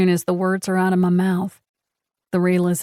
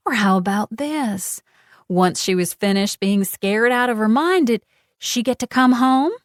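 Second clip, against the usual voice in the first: higher, mocking villain voice; taunting; 4 semitones higher than usual. A narrator's reading. Genevieve taunting Casey about her daughter. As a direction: twist the knife sweetly.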